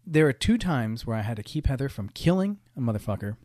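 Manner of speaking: ordinary talk, nothing marked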